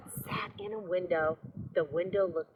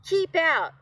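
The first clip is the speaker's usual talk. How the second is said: loud, high angry yell; 11 semitones higher, 9 dB louder than usual. A narrator's reading. Grumpy cactus chasing off the tortoise, yelling. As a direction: yell him off your land. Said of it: "yelled Hank".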